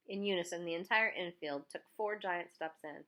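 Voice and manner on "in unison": ordinary delivery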